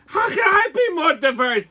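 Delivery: high, whiny mock question; mocking guilty liberal. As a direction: ape a guilt-ridden liberal. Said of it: "guilty liberal whites are".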